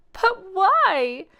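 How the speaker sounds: very high, loud, drawn-out cry; shocked. Acted. Grandmother learning Horace stole the recipe, shocked cry. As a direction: shriek his name in disbelief.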